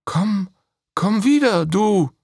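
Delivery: high, loud call after the dove. Acted. Princess calling after the departing dove, loud call. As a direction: call the bird back. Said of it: "rief Gislea".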